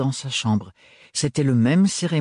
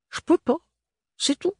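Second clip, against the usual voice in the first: put-on "Je peux pas" much higher, pleading, in a thick provincial French accent; a desperate squeaky refusal, stress on "peux"; pleading maid accent. A narrator's reading. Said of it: "L'accent très prononcé de l'adolescente".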